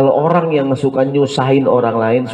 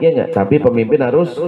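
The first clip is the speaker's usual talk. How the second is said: normal talking voice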